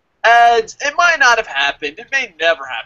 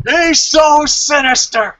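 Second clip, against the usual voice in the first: slow, dramatic commentator voice, louder; mock announcer; 6 semitones higher, 5 dB louder, slower than usual. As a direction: ham up a sinister announcer line. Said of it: "Michael Cole's like".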